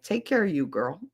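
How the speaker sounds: ordinary delivery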